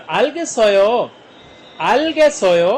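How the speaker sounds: slow model phrase. Shown as a demonstration. Korean teacher models the phrase slowly for students. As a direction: say it slowly for learners.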